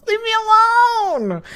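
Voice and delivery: high squealed plea through laughter; helpless high-pitched whine; laughing protest. Real, not acted. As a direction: wail it out mid-giggle, voice shooting up. Protesting while helplessly laughing at teasing.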